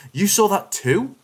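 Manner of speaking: retold yell of startled disbelief. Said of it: "jumps up from the computer and yells".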